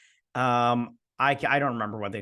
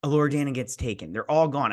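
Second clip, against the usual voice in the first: normal talking voice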